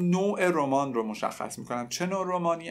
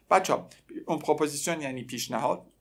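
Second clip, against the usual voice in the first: ordinary talk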